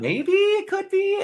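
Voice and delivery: high, slow, drawn-out musing voice; mock musing. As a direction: float the line up high and slow like a pondering character. Acting out a character's wondering line.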